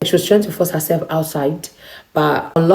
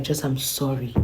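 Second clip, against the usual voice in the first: softer, imitating a crying apology; a hint of weepy pleading, much softer; tearful apology; 4 semitones lower, 11 dB quieter than usual. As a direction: retell her sobbing sorry, hushed. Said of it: "She started to cry".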